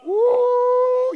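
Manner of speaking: long, high, loud "woo"; excited whoop. Real, not acted. Preacher rejoicing over ascending like Jesus, loud whoop. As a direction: let out a sustained preacher's whoop.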